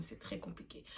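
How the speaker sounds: soufflé à voix basse, sans voix; whisper. Real, not acted. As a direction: chuchote l'aparté comme un secret. Aparté chuchoté répété. (chuchoté, sans voix)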